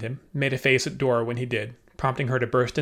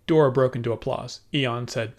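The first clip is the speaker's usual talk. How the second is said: ordinary delivery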